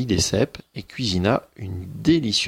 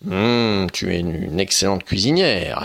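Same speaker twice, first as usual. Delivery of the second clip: louder, relishing ogre voice; satisfied ogre; 4 dB louder than usual. Acted. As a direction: savour the food as the ogre. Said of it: "affirma-t-il". (l'ogre repu, ravi et sonore)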